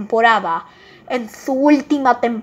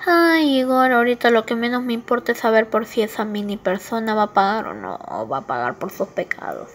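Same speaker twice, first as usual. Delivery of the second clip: usual speaking voice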